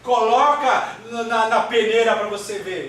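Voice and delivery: high, urgent preacher's retelling; Jesus. Acted. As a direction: warn a disciple with emphatic force. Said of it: "Por isso que Jesus fala". (voz de Jesus advertindo, em tom alto de pregador)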